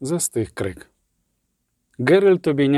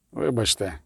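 low, soft apology; whispered; 4 semitones lower, 6 dB quieter than usual. A narrator's reading. Knight lowering his gaze before the dwarf, soft whisper. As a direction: murmur it low, nearly a whisper. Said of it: "прошепотів".

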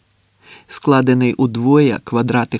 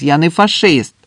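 loud, high-pitched indignant protest; raised voice, insisting; shouted protest; 6 semitones higher, 7 dB louder than usual. A narrator's reading. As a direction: shout your defence across the line. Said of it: "крикнув Андрес".

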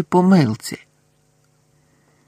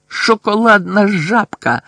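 higher, slow, spat-out angry yell; furious shout; 7 semitones higher, 3 dB louder, slower than usual. A narrator's reading. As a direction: hurl the password at the gargoyle, higher and slower. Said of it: "злісно закричав він".

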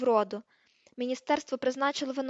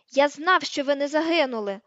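loud, forceful yell; bellowed retort; 3 semitones higher, 7 dB louder than usual. A narrator's reading. Ron finally out-shouting Hermione, bellowing. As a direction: bellow over her. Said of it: "загорлав Рон".

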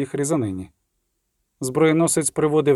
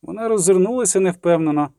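usual speaking voice

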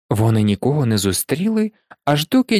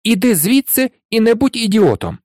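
higher-pitched, irritated order; fed up with a friend; exasperated outburst; 8 semitones higher than usual. A narrator's reading. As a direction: tell him off, patience gone. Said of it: "прорвало нарешті Рона".

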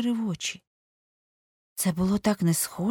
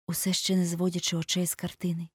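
plain reading voice

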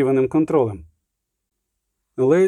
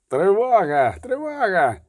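drawn-out cry, rising higher on repeat; alarm yell; 5 semitones higher, slower than usual. A narrator's reading. Floored guard commander raises the alarm, yelling. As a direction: yell the alarm without full volume. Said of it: "дерся повалений командир варти".

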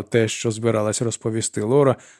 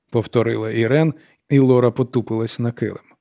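ordinary delivery

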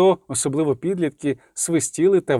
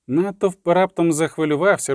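ordinary delivery

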